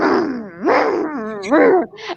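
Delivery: long, rumbling mock moose grunts; imitated moose grunt. Hiker imitates moose grunting at her, rumbling grunts. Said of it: "And it, like, grunts".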